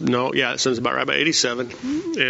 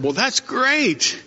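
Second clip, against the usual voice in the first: lightly voiced upbeat boy, higher and drawn out; young boy; 8 semitones higher, much slower than usual. Little boy replies to the old Swedish man, lightly imitated, high and slow. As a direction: stretch it out high, like a boy cheering someone on. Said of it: "And the little boy said".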